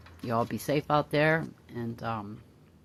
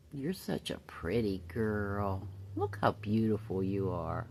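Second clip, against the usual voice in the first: soft praise; 6 semitones lower, 9 dB quieter than usual. Real, not acted. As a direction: hush it lovingly to the bird.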